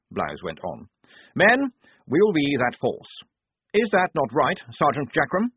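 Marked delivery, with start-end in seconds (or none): 1.3-5.5 a keen, proper lieutenant voice, pitched higher; addressing the men with eager self-importance; the lieutenant briefing his squad; earnest young officer. A narrator's reading. Blouse rallying the men.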